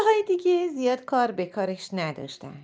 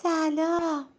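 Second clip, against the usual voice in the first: shy soft greeting; 8 semitones higher, slower than usual. Acted. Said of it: "با صدای آروم و یواش گفت".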